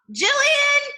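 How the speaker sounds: loud high yell of a name; calling out. Shown as a demonstration. Father calling the other son, loud high call. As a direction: call him from the next room. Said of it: "he called".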